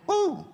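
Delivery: high, joyful whoop of praise. Real, not acted. Preacher picturing glory at heaven's gates, whooping with joy. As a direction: let out a high whoop of delight.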